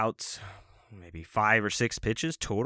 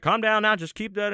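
lightly voiced coach, pitched up; coach intervening; 11 semitones higher, 4 dB louder than usual. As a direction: urge the player to settle, in a high voice. Coach calming the frustrated player.

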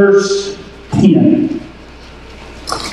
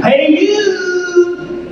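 calling out; 7 semitones higher than usual. Shown as a demonstration. Preacher prompting all to hail their neighbor, high singsong call. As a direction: sing it out to the person beside you. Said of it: "Look at your neighbor".

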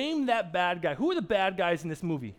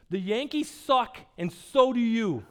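slow, emphatic jeer, growing louder; mock taunt; 3 semitones higher, 6 dB louder, slower than usual. Soccer mom heckling a man in a Yankee hat, mock taunting. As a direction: draw out the insult for comic effect. Woman's retold insult to Yankee fan.

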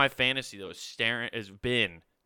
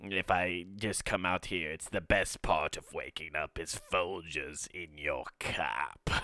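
low, soft evil emperor voice; a sinister old ruler musing; Emperor Palpatine; 5 semitones lower, 6 dB quieter than usual. Pretend. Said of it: "Emperor Palpatine trying to do words of affirmation".

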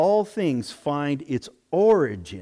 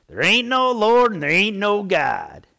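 yelling back; 6 semitones higher, 5 dB louder than usual. Retold or imitated. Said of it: "he would yell back at her".

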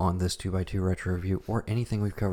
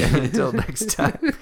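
very slow, louder Until next time through laughter; sign-off voice while laughing; 7 semitones higher, 9 dB louder, much slower than usual. Pretend. Cohost asked for his sign-off, a slow laughing until next time. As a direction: drag out the farewell mid-laugh. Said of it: "I like the sign off thing".

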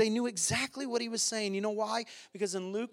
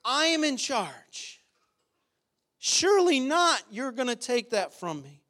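higher, insistent voice refusing God; stubborn believer; 7 semitones higher, 3 dB louder than usual. Retold or imitated. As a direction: act out someone clinging to control. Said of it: "we say to God all the time".

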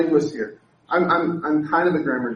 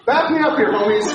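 playful loud appeal; 3 semitones higher, 4 dB louder than usual. Real, not acted. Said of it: "joking appeal to audience".

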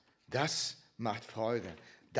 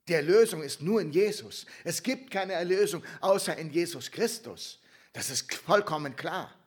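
loud, raised, emphatic proclamation; preaching at full force; 6 semitones higher, 11 dB louder than usual. Real, not acted. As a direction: declare it forcefully to the congregation. Predigt mit voller Kraft. (laut und eindringlich predigend)

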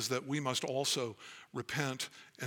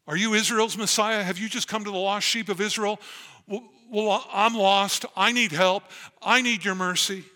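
higher and louder, a begging woman's cry; the Canaanite mother begging Jesus, higher and louder; desperate pleading woman; 7 semitones higher, 8 dB louder than usual. Acted. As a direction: plead with him for help as the lost mother. Preacher voicing the pleading woman.

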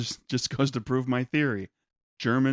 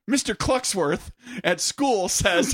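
put-on loud child voice; 8 semitones higher, 4 dB louder, slower than usual. Kid voice, slow and shouted.

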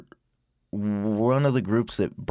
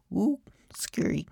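high, soft mock fright; whispery, high mock shudder; mock scared; 10 semitones higher, 5 dB quieter than usual. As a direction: tease with a pretend-spooked ooh. Mocking people afraid of bugs.

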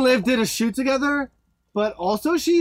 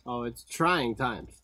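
mock solemn; 9 semitones lower, 8 dB quieter, slower than usual.

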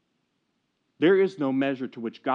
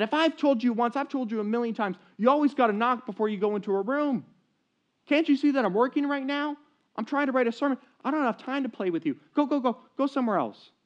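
lost temper; 10 semitones higher than usual. Retold or imitated. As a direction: snap at the child as a stressed pastor. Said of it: "Riley lost his temper".

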